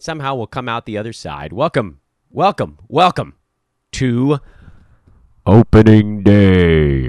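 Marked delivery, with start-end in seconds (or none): 1.5-4.4 announcer build-up. Acted. 5.4-7.0 slow, loud bellowed announcement; booming shout. Acted. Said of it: "Did I blow your ears out with that one?".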